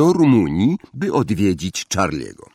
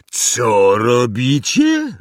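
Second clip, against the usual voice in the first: very slow, stretched incredulous question; aghast; 3 semitones higher, 4 dB louder, much slower than usual. A narrator's reading. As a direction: draw each word out in astonishment. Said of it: "Hagrid wytrzeszczył oczy". (bardzo przeciągle, z osłupieniem)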